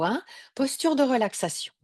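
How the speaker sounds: ordinary talk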